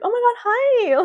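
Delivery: very high gushing hi; fake sweet greeting. Costume lady gushing at a male actor, mocked. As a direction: coo it sweetly at the man. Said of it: "She's like".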